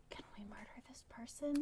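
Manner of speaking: hushed aside to the party; conspiratorial whisper. Acted. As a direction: lean in and whisper the plan.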